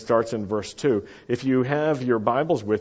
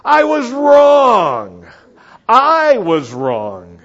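booming, drawn-out confession; loud, full-voiced enthusiasm; enthusiastic shout; 10 semitones higher, 12 dB louder, much slower than usual. Shown as a demonstration. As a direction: belt it out for the crowd to copy. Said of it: "Can you say it with some enthusiasm?".

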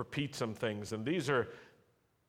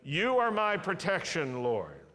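slow, loud, emphatic confession of faith; slow declaration; 6 semitones higher, 6 dB louder, slower than usual. Shown as a demonstration. Preacher leading a call-and-response, slow and loud. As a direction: proclaim each word slowly to the congregation. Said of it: "Say that with me".

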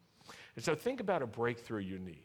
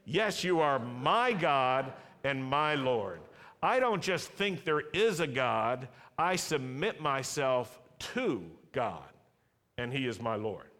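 slow, loud, emphatic confession of faith; slow declaration; 2 semitones higher, 5 dB louder, slower than usual. Shown as a demonstration. Preacher leading a call-and-response, slow and loud. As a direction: proclaim each word slowly to the congregation. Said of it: "Can you say that?".

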